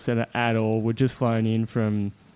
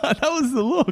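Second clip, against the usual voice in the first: high, laughing agreement; real laughing; 14 semitones higher than usual. Real, not acted. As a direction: speak between bursts of laughter. Laughing at own proposal nerves.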